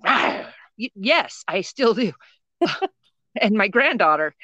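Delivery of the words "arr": jump-out-and-scare growl; mock monster growl; playful growl. Shown as a demonstration. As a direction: growl as if pouncing on the kids. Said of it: "I used to growl at my kids".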